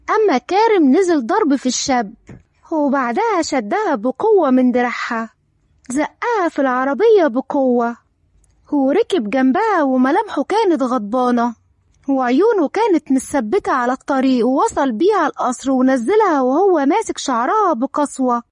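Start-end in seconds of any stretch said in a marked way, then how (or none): none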